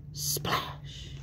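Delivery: a breathy whispered splash. A narrator's reading. Narrator whispers the splash as Tad jumps in. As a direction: hush the sound effect.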